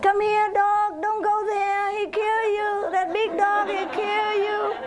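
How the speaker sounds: high sing-song warning to the dog; mock neighbor calling dog. Neighbor sing-songs a fake warning to her dog, high.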